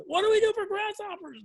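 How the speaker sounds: high, far-up frantic squeak of a question; panicked question. Retold or imitated. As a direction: imitate himself on the edge of panic. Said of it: "almost panicking".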